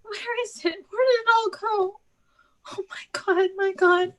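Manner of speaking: high, tearful lament through sobs; crying. Acted. Irina weeps to her sisters over what she has lost, high and tearful. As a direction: weep as you search for what is lost. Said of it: "Don't cry".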